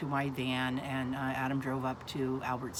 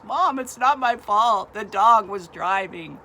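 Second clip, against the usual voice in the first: loud, high, drawn-out wail; imitating a distraught teenager; protesting son; 6 semitones higher, 11 dB louder, slower than usual. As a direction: plead innocence at full volume. Son protesting after the crash.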